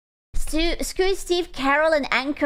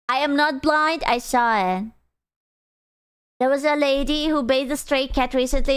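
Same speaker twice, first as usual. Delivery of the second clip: normal talking voice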